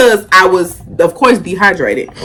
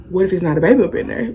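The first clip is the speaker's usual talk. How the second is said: fast, much softer, half to herself; worried aside; 2 semitones lower, 6 dB quieter, much faster than usual. Retold or imitated. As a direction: whisper the fear quickly. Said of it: "I'm kinda like".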